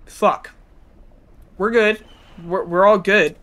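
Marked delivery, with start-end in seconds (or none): none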